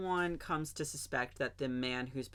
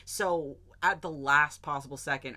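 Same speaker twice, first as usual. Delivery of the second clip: normal talking voice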